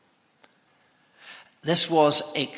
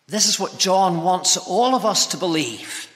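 usual speaking voice

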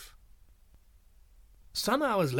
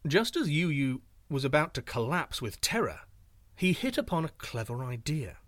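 ordinary delivery, nothing marked

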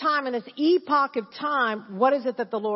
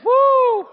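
ecstatic woo; 13 semitones higher, 11 dB louder than usual. Real, not acted. Ecstatic cry.

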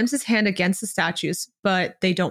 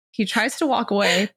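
usual speaking voice

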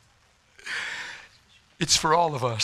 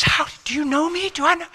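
put-on bewildered girl; 10 semitones higher than usual.

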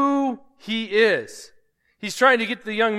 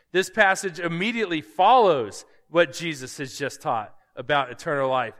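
usual speaking voice

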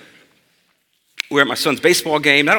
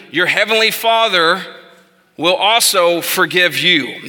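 preaching; 5 semitones higher than usual. Acted. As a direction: preach the verse with fervour, stretching key words. Pastor preaching the scripture text.

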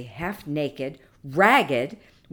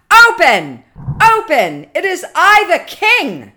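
loud, high, imperious demand; angry shout; 12 semitones higher, 13 dB louder than usual. Acted. King shut in church demands the door open, shouting angrily. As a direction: bellow like an enraged king locked inside. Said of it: "angry voice from within".

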